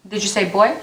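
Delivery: ordinary delivery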